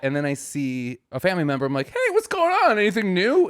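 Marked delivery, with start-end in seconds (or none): 1.9-3.5 high, loud overly bright greeting; fake casual. Greeting family member with forced cheer. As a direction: pretend nothing happened.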